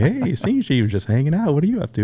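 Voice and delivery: high-pitched mock-innocent retold answer; innocent casual reply. Retold sheepish reply to a superior.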